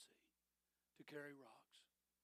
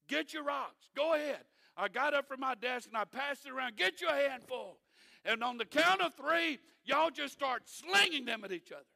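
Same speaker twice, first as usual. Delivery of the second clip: mock challenge; 7 semitones higher, 10 dB louder than usual. Pastor mockingly urges stoning.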